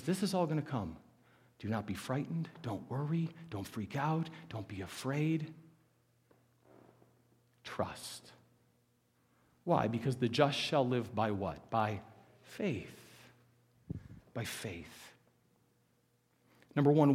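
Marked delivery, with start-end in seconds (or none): 0.0-8.3 much softer, gentle reassurance; hushed, tender voice of comfort; soft reassuring reading. Real, not acted. Jesus' words read softly. 11.7-15.1 much softer, almost breathed; hushed response; soft answer. Real, not acted. Answer said softly.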